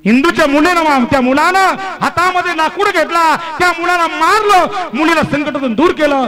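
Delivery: high, loud rapid-fire storytelling without a letup; impassioned preacher's narration; full-force preaching. Real, not acted. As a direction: drive the action home to the crowd.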